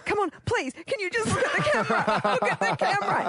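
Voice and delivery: high-pitched begging, getting desperate; hammed-up desperate mum; needy mum. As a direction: plead with the kid in a rushed high voice. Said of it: "I was that weird, needy mum".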